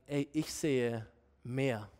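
low, soft and slow; quiet encouragement. Acted. God encourages those in their struggle, low and tender. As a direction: tenderly assure them, taking time. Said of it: "Gott ermutigt sie".

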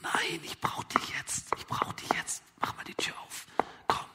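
urgent whisper. Acted. Friend pressing the father to open up, urgent whisper. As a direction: keep pushing, whispering.

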